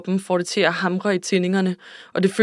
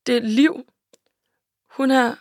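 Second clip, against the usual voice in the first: higher, lightly tearful voice; tearful, breaking off; 5 semitones higher than usual. A narrator's reading. Sue cannot finish telling her friend, mildly tearful. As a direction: start the news, then stop unfinished. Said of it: "men græder lydløst".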